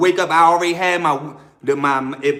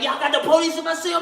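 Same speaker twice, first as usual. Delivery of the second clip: retold yelling; 9 semitones higher than usual. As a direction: call out a high, accusing question. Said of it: "So I'm yelling now".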